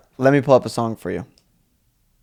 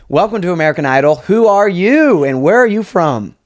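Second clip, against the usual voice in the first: louder and higher, the question slowed; a judge calling a contestant onstage; talent show judge; 4 semitones higher, 6 dB louder than usual. Pretend. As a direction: play the grand TV judge. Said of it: "I'll be, like, [name] or something".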